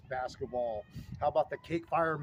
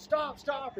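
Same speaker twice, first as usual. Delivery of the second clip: much higher mock ref call; mock ref calling stop; 10 semitones higher than usual. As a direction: imitate the ref halting play. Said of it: "and the ref goes".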